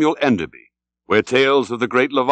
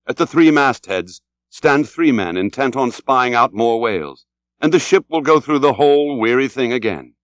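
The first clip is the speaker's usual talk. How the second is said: usual speaking voice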